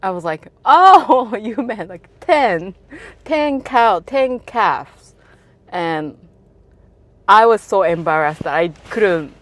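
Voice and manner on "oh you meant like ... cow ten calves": loud, high "oh, I get it"; laughing burst of understanding; sudden realisation. Retold or imitated. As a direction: exclaim as the penny drops.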